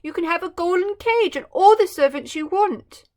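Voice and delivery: high, loud coaxing plea; begging Emperor. Acted. The Emperor bribing the bird to stay, pleading. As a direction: coax and plead. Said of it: "he begged the little bird".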